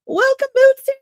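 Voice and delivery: excited greeting. Real, not acted. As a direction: call out the name happily. Greeting a chatter again.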